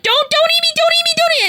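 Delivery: high, loud panicked pleading, re-enacted; retold screaming. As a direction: re-enact a frantic scream, begging to be spared. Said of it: "I started screaming as she ran at me".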